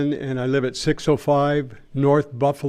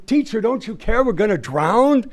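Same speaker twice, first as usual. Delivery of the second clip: mild storyteller's shout; 9 semitones higher than usual. A narrator's reading. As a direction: voice panicked disciples without really shouting. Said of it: "his disciples woke him up shouting".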